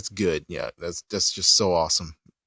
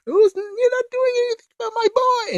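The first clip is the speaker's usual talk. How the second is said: very high falsetto angry mom; high falsetto outraged mom; Chi-Chi impression. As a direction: screech it like a furious mother in falsetto. Chi-Chi voiced in recap.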